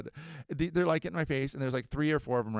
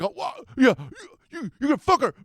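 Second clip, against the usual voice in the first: mock dumb kid; 11 semitones higher, 8 dB louder than usual. Mock kid granting permission.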